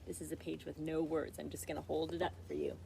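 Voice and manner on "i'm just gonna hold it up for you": ordinary delivery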